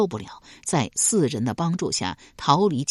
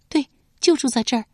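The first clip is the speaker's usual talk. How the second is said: put-on higher, fast, eager girl's voice; 少女轻快作答; quick girl's reply; 9 semitones higher, faster than usual. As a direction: answer brightly and quickly. Yan'er answers quickly.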